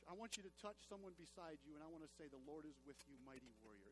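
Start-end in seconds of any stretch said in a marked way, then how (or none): 2.3-3.9 very low, soft and subdued; understated delivery. Shown as a demonstration. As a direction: drop the voice right down, almost throwaway. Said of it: "say it like you mean it".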